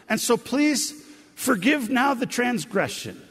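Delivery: acted plea, high and loud; laid-on-thick pleading. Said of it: "They're really laying it on thick".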